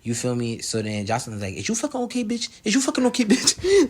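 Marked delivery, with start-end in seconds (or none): 1.5-3.9 high-pitched mock confrontation; taunting. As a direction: taunt them in a high voice, breaking into laughter. Retelling Jocelyn antagonizing Egypt.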